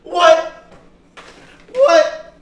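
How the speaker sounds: loud, high hyped yelling; laughing disbelief, shouting; excited shouting. Real, not acted. Hosts hyped after the clip.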